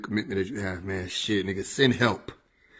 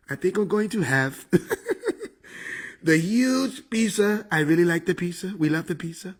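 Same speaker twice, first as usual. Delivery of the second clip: Trump; 6 semitones higher than usual. Acted. Comedian plays the president ordering pizza, Trump impression. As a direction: savor each phrase like a boastful politician. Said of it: "Trump impression was spot on".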